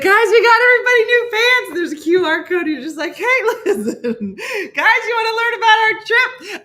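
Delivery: high, loud, laughing pitch voice; squeaky, giggly sales pitch to strangers; cheesy tour guide. Retold or imitated. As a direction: hawk the trip like an overeager promoter. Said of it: "I'd be like".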